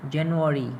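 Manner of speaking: ordinary delivery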